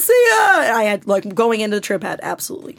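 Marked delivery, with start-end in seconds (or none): 0.0-0.6 high shouted farewell; mock shout. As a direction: yell a goodbye on the way out. Shouted goodbye while leaving.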